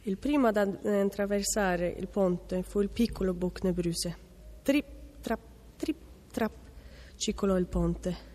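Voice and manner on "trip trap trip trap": high, light little trip-trap steps; tiny hooves tapping out each word separately; light hoofsteps. A narrator's reading. Small goat's hooves on the bridge. (zoccoletti leggeri, acuti e staccati)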